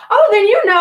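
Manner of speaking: high, loud "oh then you know"; mock surprised friend. Boyfriend's friend agreeing with her, high surprised voice.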